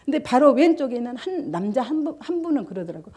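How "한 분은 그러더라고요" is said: normal talking voice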